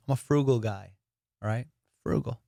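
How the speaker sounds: ordinary talk, nothing marked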